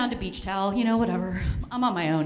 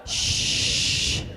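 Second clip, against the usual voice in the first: shushing. Real, not acted. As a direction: hush yourself with a shh. Shushing self mid-story.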